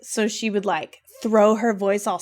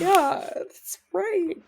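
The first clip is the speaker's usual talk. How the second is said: squeaky higher voice for a sing-song yes; high mock agreement; 9 semitones higher, much slower than usual. To her friend, sing-song high mock agreement. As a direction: voice her higher for that's right.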